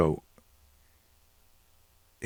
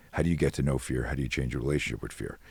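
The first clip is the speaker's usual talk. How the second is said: ordinary talk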